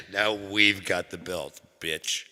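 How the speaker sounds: slow, loud, high gloating; slow gloating kid. Retold or imitated. Kid bragging.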